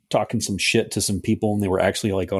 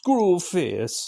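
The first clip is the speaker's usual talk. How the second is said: higher-pitched mock Jamaican echo; bad Jamaican accent; 7 semitones higher than usual. Co-host echoing the villain's name, mock Jamaican accent. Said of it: "It was bad Jamaican accent".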